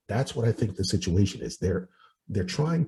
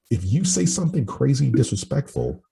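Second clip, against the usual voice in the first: ordinary talk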